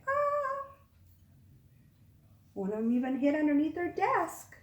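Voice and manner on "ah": much higher startled ah; mock frightened cry. Reader imitating the scared kids, high frightened cry. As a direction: squeak like a scared child. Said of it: "look at them looking like".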